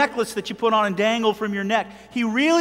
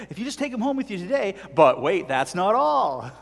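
mock infomercial. Preacher parodying packaged Jesus, mock infomercial pitch. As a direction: pitch it like a TV product offer. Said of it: "We package him up and say".

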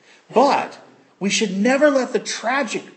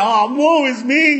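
mock lament; 7 semitones higher, 7 dB louder, slower than usual. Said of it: "She could have gone".

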